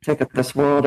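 low, grumbling boyfriend impression; mock world-weary grump; grumpy old man. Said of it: "he's the grumpy".

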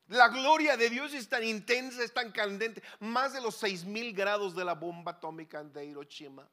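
loud, driving sermon voice; preaching at full force. Acted. Preacher proclaiming God's glory, loud full-force preaching. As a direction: preach it out to the congregation.

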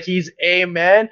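slow "He's a man", leaning on "a" as AY; stress on A. Retold or imitated. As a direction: draw out "a" to double down mockingly. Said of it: "my friend just doubled in on".

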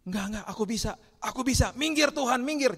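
high mock protest building to a shout; stubborn refusal. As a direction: shoo the helper away in acted panic. Acting stubborn faller refusing Jesus' help.

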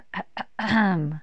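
firmer throat clearing. Acted. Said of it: "Kenny cleared his throat".

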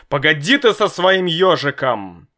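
a father on edge cutting his son off; father nervously brushing off the hedgehog talk. A narrator's reading. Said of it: "нервно сказал папа".

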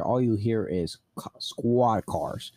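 normal talking voice

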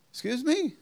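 high, startled "excuse me?"; a mother who doesn't recognise her own son; sharply rising surprised query; mom's puzzled question. Retold or imitated. Mom at door not recognizing son.

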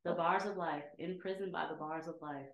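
low, fading dramatic recital; solemn poem recital. Acted. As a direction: recite it like a brooding poet.

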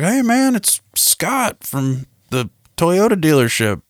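very high, drawn-out greeting; over-friendly salesman. Retold or imitated. Salesman reintroducing himself by phone, mock-friendly high voice. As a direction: imitate an eager salesman in a put-on high voice.

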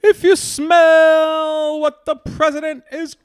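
high, loud, slow wrestling-promo boom; hammed-up Rock catchphrase, stretched out; Rock impression. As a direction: bellow it like The Rock cutting a promo. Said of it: "part of his speech".

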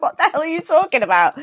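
hammed-up disbelief from dad; incredulous dad. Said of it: "He's like".

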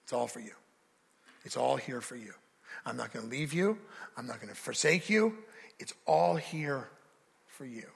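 put-on gentle, hushed comfort for a weary child; God's tender reassurance. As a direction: soothe them like a father at their shoulder. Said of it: "and says".

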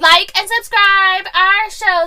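slow, loud YouTuber sign-off; clickbait video narrator. Acted. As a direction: hype the outro like a YouTuber. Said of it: "my YouTuber voice".

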